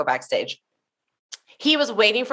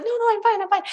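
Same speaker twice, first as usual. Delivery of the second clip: flustered refusal; 13 semitones higher, much faster than usual. Retold or imitated. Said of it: "And I was like".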